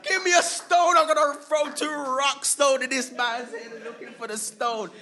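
high, loud laughing mock mob man; hammed-up hunt for a stone; angry mob member.

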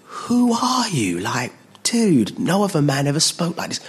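high wondering swoop, then settling; awed amazement. Acted. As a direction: marvel at him like an impressed mate. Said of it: "it could have been".

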